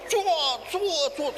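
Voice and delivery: rapid-fire swish sounds; imitated swerving car. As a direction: mimic a car darting through traffic. Mimics car swerving past others.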